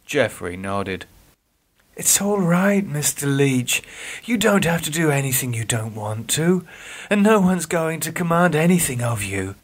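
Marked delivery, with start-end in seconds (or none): 2.0-9.6 gentle higher nurse voice; smiling reassurance. Acted. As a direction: reassure him kindly. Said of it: "she smiled".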